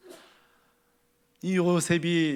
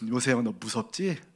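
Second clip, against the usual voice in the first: tender reassurance; 6 semitones lower, 5 dB quieter than usual. Acted. God reassures Joseph.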